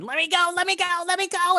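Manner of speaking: high, loud frantic shriek, repeated; screaming kobold. Acted. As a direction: scream like a small creature struggling to get free. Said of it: "it's just screaming".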